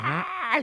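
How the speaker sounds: high held "ah" imitating a witch; horrible high-pitched shriek. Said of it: "really horrible high pitch".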